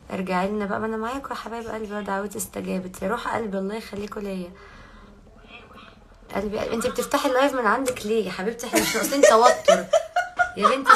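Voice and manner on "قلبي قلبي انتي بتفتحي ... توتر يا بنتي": laughing, rising high and loud; laughing protest. Real, not acted. Laughing at her friend for opening a live at the same time. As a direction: protest through laughter, climbing to a squeal.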